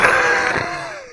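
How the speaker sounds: laughing at the absurdity; shrieking laugh. Real, not acted. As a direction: burst out in a squealing laugh. Laughs loudly.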